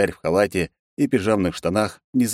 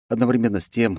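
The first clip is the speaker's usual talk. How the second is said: ordinary delivery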